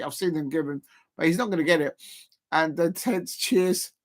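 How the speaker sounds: ordinary delivery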